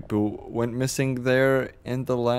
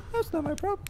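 squeaky high-pitched mockery, softer; mocking high voice; 8 dB quieter than usual. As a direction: sneer it back in a tiny high voice. Mocking the rude driver again.

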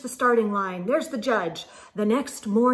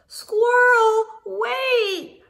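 high, louder call after her; 9 semitones higher, 4 dB louder, much slower than usual. Acted. Friends calling after Squirrel again, high call. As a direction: call to someone racing away. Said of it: "her friends called once more".

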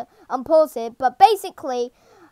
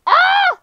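very high startled scream; high shriek; 4 dB louder than usual. Real, not acted. Player attacked in the game, high shriek. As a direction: shriek in sudden panic. Hit during the game fight.

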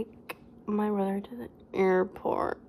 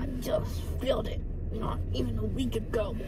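ordinary talk, nothing marked

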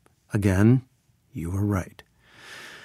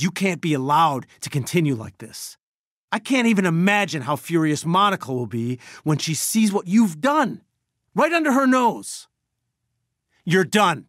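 indignant, climbing to a high peak; furious threat; 6 semitones higher than usual. Acted. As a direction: build in outrage to a final verdict. Threatening to expose the doctor.

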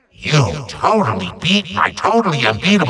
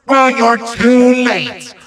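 normal talking voice

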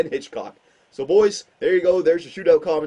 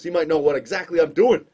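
ordinary delivery

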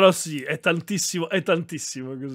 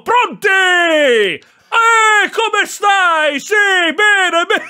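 mock roar that the whole street could hear; imitated phone shouting; 9 dB louder, much slower than usual. Streamer imitates his father answering the phone, shouting. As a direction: bellow high and loud, mimicking dad on the phone. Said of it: "Scusa, ma perché urli?".

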